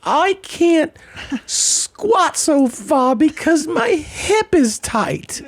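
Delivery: high, slow mock whine; mock-pathetic whining about his hip; whiny friend imitation. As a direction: drag it out like a complaining wimp. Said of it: "and he goes".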